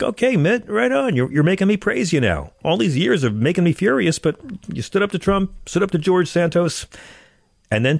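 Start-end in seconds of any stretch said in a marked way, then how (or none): 0.0-6.9 excited quote. Retold or imitated. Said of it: "I'm like".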